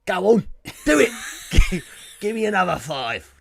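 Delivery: bossy hammed-up English accent; mock English accent. Imitating Yasmin ordering press-ups, exaggerated English accent. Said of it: "that's the accent that I give Yasmin".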